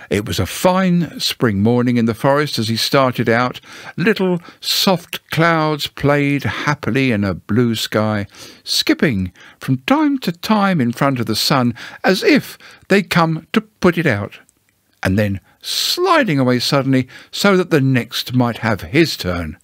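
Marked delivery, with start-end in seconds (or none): none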